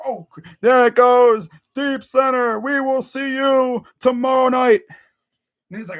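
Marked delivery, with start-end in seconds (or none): 0.6-4.8 slightly raised, drawn-out broadcast call; fake hyped. Fan mocking Joe Buck calling a homer, fake hyped. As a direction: put on a sportscaster's forced excitement. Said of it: "fake hyped".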